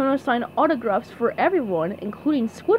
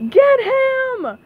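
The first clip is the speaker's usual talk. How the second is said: high, loud mob cry; yell; 14 semitones higher, 7 dB louder than usual. A narrator's reading. Angry crowd turning on SpongeBob, yelling. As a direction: yell it to set a crowd charging. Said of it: "they yelled".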